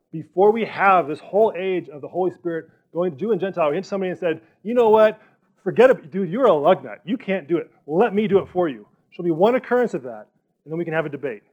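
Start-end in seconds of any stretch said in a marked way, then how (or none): 4.6-8.9 loud, high, scornful brush-off; a hammed-up scornful put-down; mock dismissive. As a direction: sneer it like a bored know-it-all. Mocking imagined Jesus dismissing someone.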